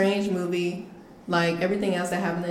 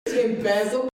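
laughing; 4 semitones higher, slower than usual. Retold or imitated. Mocking a friend's pronunciation.

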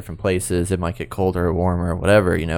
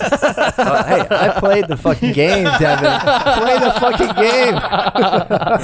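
high laughing retort, drawn out; laughing mock retort; 13 semitones higher, 3 dB louder, much slower than usual. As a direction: laugh through a mock comeback. Mocking reply, laughing.

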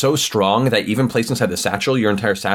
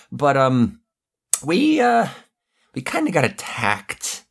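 much higher, pleading, nervous henchman; nervous goon; 5 semitones higher, slower than usual. Acted. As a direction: beg the boss not to be angry, voice up high. Goon explains to Petrov.

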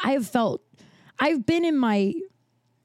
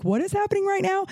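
imitating baffled friends; 10 semitones higher than usual. Imitating friends waiting during the standoff, baffled. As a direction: voice the puzzled onlookers, pitched way up.

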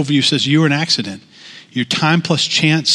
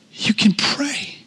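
dramatic whispered line, higher than usual; 7 semitones higher, 5 dB quieter, slower than usual. Acted. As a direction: lean in and breathe it, unvoiced. Preacher drops to whisper for effect.

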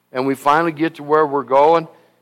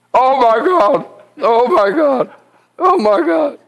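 higher, louder, frantic cries of oh my God; panicked hollering; 10 semitones higher, 5 dB louder than usual. Retold or imitated. Scared preacher on the landing plane, frantic higher holler. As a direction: mimic a terrified preacher calling out in the storm. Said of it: "My God, holler".

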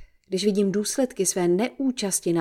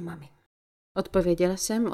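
ordinary delivery, nothing marked

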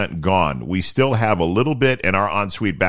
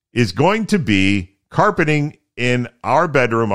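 ordinary talk

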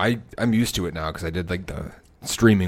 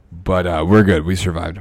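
ordinary delivery

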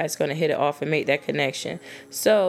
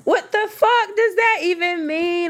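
much higher, slow, stretched out in disbelief; outraged disbelief; 13 semitones higher, 4 dB louder than usual. Real, not acted. Venting to ladies about a guy's double standard, outraged and high. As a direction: let the outrage pull the pitch way up. Ranting about hypocritical guys.

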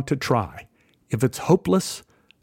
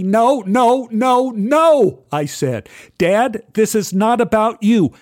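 higher, louder furious no, no; angry shout; 7 semitones higher, 4 dB louder, slower than usual. Retold or imitated. Father shouting back at his son over the dying cat. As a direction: shout him down, hurt and angry. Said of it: "I shouted back".

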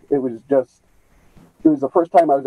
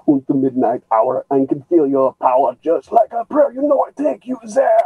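put-on sung in a heavy German accent; thick German accent; 4 semitones higher than usual. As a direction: croon it like a German. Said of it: "I do it in a thick German accent".

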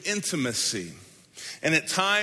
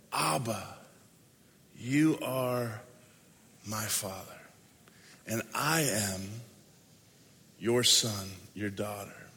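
a soft, low, intimate cry to a father; tender hushed prayer; 6 semitones lower, 6 dB quieter than usual. Pretend. The Spirit inside crying out to the Father, hushed and tender. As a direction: breathe it out like a child calling its father.

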